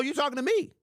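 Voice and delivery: high incredulous "you talking to me"; hammed-up disbelief; mock offended. Repeats mock offended bit.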